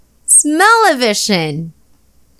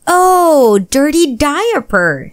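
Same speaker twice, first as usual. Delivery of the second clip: sudden realization; 6 semitones higher, slower than usual. Acted. Dad realizes Zeke's diaper needs changing, surprised. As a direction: catch on all at once.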